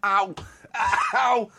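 high, pained laughing ow; laughing through pain. Real, not acted. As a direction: wince while still laughing. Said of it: "It hurts to laugh".

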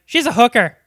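loud, blunt blurt. Retold or imitated. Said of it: "she's the only one who's like".

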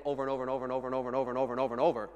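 very fast, run-on and comically endless; exasperated repetition. Acted. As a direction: rattle it off in a breathless-fast string. Exaggerates endless misbehaviour.

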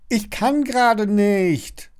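high, slow, pleading shout; drawn-out high wail of excuse; desperate call. A narrator's reading. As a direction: call it out in despair, dragging the words. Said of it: "ruft Lilly verzweifelt".

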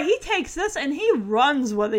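ordinary talk, nothing marked

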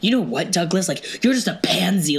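ordinary talk, nothing marked

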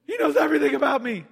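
high, loud and fast, thrilled she's been seen; excited woman at the well, high and fast. Acted. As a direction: gush it out quickly, surprised and delighted. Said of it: "And she's like".

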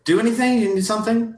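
very high, flustered fussing voice; fussy hostess. Acted. Mrs Garrison fussing over a choking guest, high and flustered. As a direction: flutter over the coughing guest in a shrill-free high pitch.

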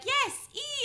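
very high-pitched cheery confirmation; mock misunderstanding. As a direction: squeal back the wrong letter, confidently. Mishearing shop assistant, acted.

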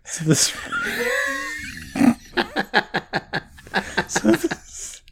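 breaking into giggles mid-sentence; trying to talk through laughter; laughing. Real, not acted. Cracking up mid-joke.

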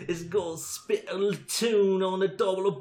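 high, mock Cockney accent. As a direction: put on a hammy Cockney voice.

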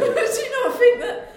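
high, loud laughing protest. Acted. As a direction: push back through laughter. Laughing character pushback.

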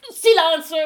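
loud, high angry shout of Silence; sharp call for silence. Acted. Judge silencing the laughing room, loud angry shout.

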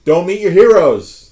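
loud, slow, drawn-out declaration; loud punchline. Acted. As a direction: boom it out slowly. Punchline about short wrestlers.

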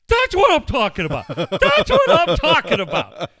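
very high, booming shout of triumph; losing it. Retold or imitated. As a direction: imitate a coach erupting at full volume. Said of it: "Bud lost his shit".

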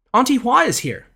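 high bright announcement; announcing. A narrator's reading. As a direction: call out the arrival. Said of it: "announced the other maid".

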